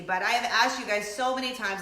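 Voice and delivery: ordinary talk, nothing marked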